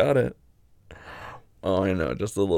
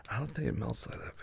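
whispering; 10 dB quieter than usual. Real, not acted. Intimate low talk.

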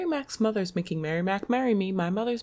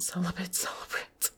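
breathy whisper, much softer; 7 dB quieter than usual. Shown as a demonstration. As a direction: breathe the words almost silently.

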